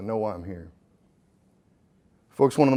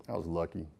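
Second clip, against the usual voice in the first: a soft low murmur, much quieter; quiet echo; 9 semitones lower, 14 dB quieter than usual. Shown as a demonstration. Speaker to the crowd, repeating the point, hushed and lower. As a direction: let the words fall away almost to nothing.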